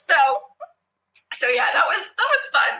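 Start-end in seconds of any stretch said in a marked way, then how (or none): none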